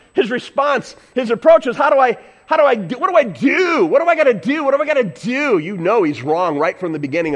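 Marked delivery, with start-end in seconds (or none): 1.7-5.6 quick, eager, pressing questions; rushed and eager asking; eager questioning. Retold or imitated. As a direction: play the keen young man asking. Voicing eager rich ruler.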